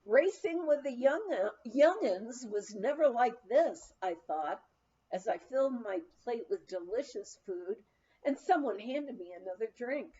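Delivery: ordinary delivery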